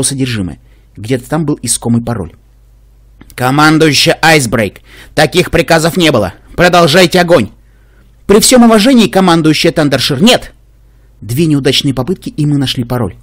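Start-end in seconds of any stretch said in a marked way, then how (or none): 3.3-7.5 angry command. Acted. Приказ командующей по радио. 8.3-10.5 high, loud, firm no; defiant refusal. Acted. Дерзкий отказ по радио.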